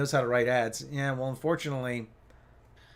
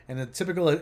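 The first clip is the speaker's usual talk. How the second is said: ordinary talk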